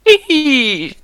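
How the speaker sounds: squeaky teasing giggle; high giggle. Acted. Teasing giggle during game.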